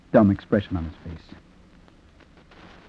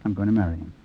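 low, understated quote; matter-of-fact; 4 semitones lower, 7 dB quieter, faster than usual. Retold or imitated. Narrator quotes Helen's announcement, matter-of-fact. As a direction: repeat it simply, without any fuss. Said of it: "Matter-of-factly, just like that".

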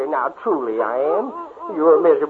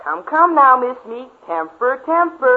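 mocking tease; 6 semitones higher, 4 dB louder than usual. Rival mocks angry girl.

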